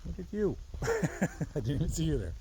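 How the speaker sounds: high, cooing voice to a dog; pet talk. Real, not acted. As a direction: coo at the pet.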